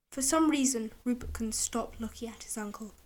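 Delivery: ordinary delivery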